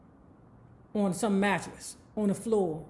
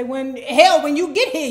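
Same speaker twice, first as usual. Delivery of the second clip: loud, raised-pitch retort; indignant comeback; 4 semitones higher, 9 dB louder than usual. Retold or imitated. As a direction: fire the warning right back, loud. Winter snaps the warning back.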